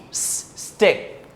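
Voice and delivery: long unvoiced S hiss, then stick; S hissed before stick. Shown as a demonstration. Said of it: "let's read".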